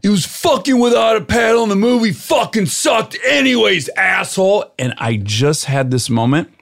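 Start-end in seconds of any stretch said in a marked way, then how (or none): 0.0-4.7 imitating a high-pitched angry yell. Bodybuilder twenty cars away in the parking lot, acted high yell. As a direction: yell an insult across the lot. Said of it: "And he yells".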